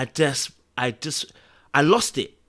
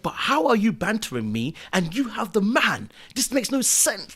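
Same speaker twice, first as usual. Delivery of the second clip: much higher, indignant blow-up; retold outburst; 9 semitones higher than usual. At uni, to a girl bantering him in public: higher indignant outburst. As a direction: call her out in front of everyone. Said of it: "I blew it out to everybody".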